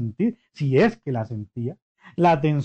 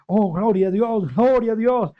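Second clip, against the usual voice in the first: raised voice, a bit higher; higher, louder glorifying cry; shouting praise; 6 semitones higher, 3 dB louder than usual. Retold or imitated. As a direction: proclaim it for all to hear.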